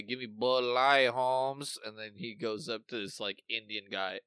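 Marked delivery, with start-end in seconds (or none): 0.0-1.8 put-on East LA accent. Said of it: "in East L A, you say".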